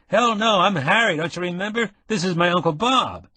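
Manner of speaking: put-on brash young Harry. Nick bursts in on the wounded man, high and excited. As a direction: play the concerned buddy, eager.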